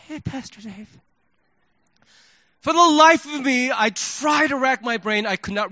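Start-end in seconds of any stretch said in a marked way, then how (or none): 0.1-0.9 much softer, timid little greeting; mousy hello. Retold or imitated. Pastor imitating a shy church member, mousy and soft. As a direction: shrink into a barely-there hello. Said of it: "the way she said hello to me was".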